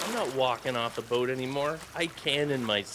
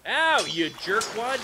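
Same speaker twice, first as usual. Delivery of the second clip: high, slow pained complaint; annoyed ow; 9 semitones higher, slower than usual. Real, not acted. Player struck by a pirate, high slow annoyed ow. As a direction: yelp at the enemy who hit you. Hit by enemy.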